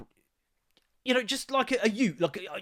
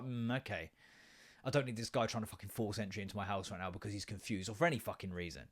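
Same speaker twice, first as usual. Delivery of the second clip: wary inner voice; 7 semitones lower, 10 dB quieter than usual. Retold or imitated. Protection mode inner thoughts.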